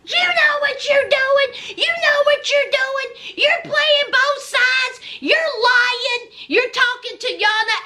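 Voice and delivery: rip into him like a furious wife; angry yelling. Retold or imitated. Wife yelling at mother-in-law on camera, furious. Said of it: "Z was like".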